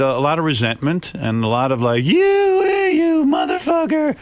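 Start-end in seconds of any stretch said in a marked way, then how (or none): 2.0-4.1 high-pitched, hammed-up angry shout; mock angry outburst. Host voicing a resentful ex-colleague, angry mock shout. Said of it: "had a lot of anger toward me".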